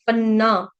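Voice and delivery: पन्ना with a doubled, held न; geminated न in पन्ना; double consonant. Shown as a demonstration. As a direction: linger on the nn of पन्ना. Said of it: "double न का उच्चारण करेंगे".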